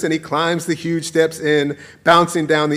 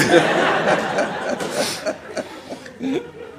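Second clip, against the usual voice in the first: breathy laugh; 7 semitones higher than usual. Real, not acted. Laughs after punchline.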